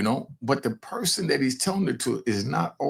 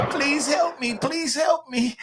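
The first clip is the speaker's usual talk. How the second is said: mock scream for help; 13 semitones higher, slower than usual. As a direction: ham up a terrified plea. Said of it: "made [name] scream".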